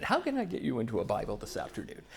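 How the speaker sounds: hint of a quick, soft-spoken salesman; lightly put-on sales patter, low and fast; car salesman. Speaker jokingly pitching Bible reading like a car salesman, lightly mock salesman.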